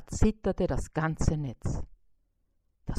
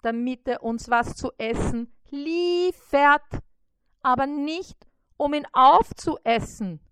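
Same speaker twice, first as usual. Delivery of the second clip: scolding fly; 6 semitones higher, 5 dB louder than usual. A narrator's reading. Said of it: "sagte Bissi".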